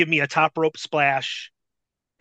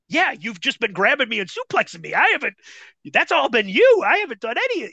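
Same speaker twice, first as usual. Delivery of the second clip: retold indignant protest; 7 semitones higher than usual. Rookie answering Bull about being suplexed, high indignant protest.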